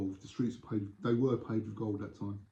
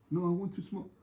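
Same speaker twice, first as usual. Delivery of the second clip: higher-pitched insistent foreign visitor; acted tourist; 7 semitones higher than usual. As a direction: repeat it as a tourist not understood. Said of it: "He's a tourist".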